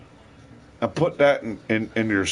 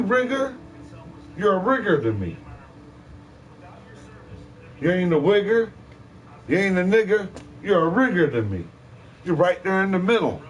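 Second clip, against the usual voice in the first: acted name-calling rant; 6 semitones higher than usual. Ranter hurling names at someone in the middle, high mocking scorn. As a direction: sneer it high, rising on the jabs.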